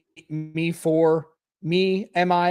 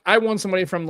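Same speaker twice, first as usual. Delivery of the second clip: ordinary talk, nothing marked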